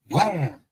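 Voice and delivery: high-pitched vocal wham; punchy sound-effect wham; imitated impact noise. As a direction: voice the crash of a thrown pipe. Imitating a pipe hitting ground.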